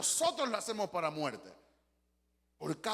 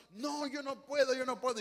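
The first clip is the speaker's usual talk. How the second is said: higher, whining "yo no puedo"; despairing helpless person; 6 semitones higher than usual. Retold or imitated. Preacher imitating a despairing person refusing help, whiny and high. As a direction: plead that you can't, like someone drowning in a glass of water. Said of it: "Y tú dices".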